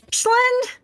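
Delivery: high, loud call of a name; shouting. Acted. Headlights ruins the stealth calling a friend, shouting high. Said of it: "by shouting".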